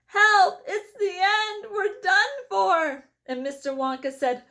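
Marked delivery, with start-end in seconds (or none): 0.1-3.1 high, loud cry of panic, dragging slow at the end; terrified shout. A narrator's reading. Grandpa Joe as the elevator crashes through the roof, terrified cry. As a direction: wail that all is lost. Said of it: "And Grandpa Joe shouted".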